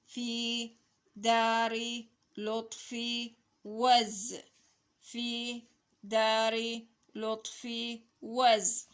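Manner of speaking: drawn-out word-by-word reading; slow model reading. Shown as a demonstration. Teacher reading a sentence to children, slow model reading. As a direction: separate each word and hold its vowel.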